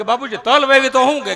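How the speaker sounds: put-on eager boy's question. Boy asks father.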